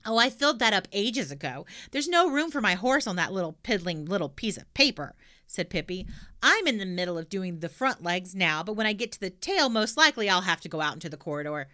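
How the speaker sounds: put-on loud, bold little girl; cheeky girl voice. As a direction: blurt it out brashly. Pippi explains her drawing.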